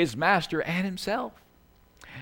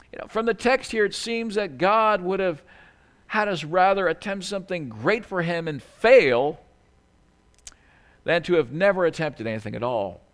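ordinary talk

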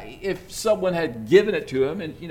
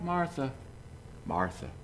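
softer, tender "Martha, Martha", falling away; gentle calling; 10 dB quieter than usual. Shown as a demonstration. Jesus gently calling busy Martha, soft and kind. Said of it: "It's kinda like".

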